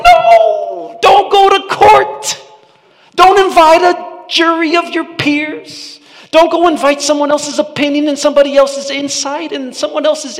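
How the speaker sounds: high, loud pleading yell; yelling at Boaz. Retold or imitated. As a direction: shout him out of going to court. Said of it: "I'm yelling in my Bible".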